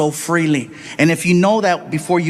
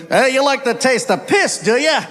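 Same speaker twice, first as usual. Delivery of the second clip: put-on higher-pitched, needling Aussie accent; Australian bartender; 6 semitones higher than usual. Bartender to American ordering Foster's, needling Australian accent. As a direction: challenge him in an Australian accent. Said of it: "Bartender looked at me".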